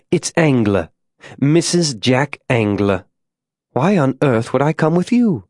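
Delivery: ladylike figure, incredulous at being invited; haughty Mrs. Angler. Acted. Molly refuses the invitation.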